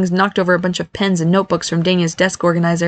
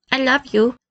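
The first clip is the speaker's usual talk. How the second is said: put-on little child's voice; 6 semitones higher than usual. A narrator's reading. Little girl's note read out.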